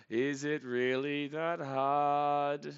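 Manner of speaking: very slow, every word stretched out; exasperated drawl. Real, not acted. Fan pleading with the pitcher, exasperated drawl. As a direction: drag out the question in exasperation.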